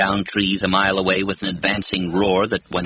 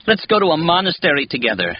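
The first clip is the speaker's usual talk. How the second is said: said madly; 8 semitones higher, 3 dB louder than usual. A narrator's reading. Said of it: "she adds madly".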